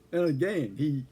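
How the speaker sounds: usual speaking voice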